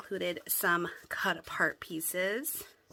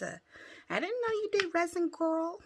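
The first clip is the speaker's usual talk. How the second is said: high, delighted squeal of praise; squealing with delight at a friend's work; excited gush; 9 semitones higher than usual. Real, not acted. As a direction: gush over the gift, pitched way up. Gushing over resin gift pieces.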